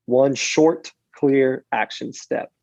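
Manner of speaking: slow, weighted clear action step; unhurried, clear emphasis; slow, deliberate. Shown as a demonstration. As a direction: stretch each word of clear action step. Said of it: "demonstrates clear slow call".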